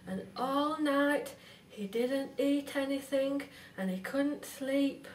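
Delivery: ordinary delivery, nothing marked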